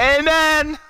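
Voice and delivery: high, loud drawn-out outburst; sudden mock shout. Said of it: "all of a sudden you just hear".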